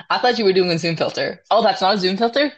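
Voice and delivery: rattled off quickly; fast gushing. Real, not acted. Rapid gushing.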